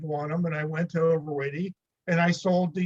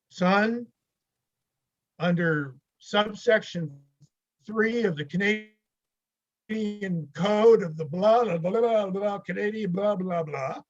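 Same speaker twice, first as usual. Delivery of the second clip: mock official recital; 4 semitones higher than usual. Interviewer citing the Canadian code, mock-official recital. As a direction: recite regulations pompously, trailing into blahs.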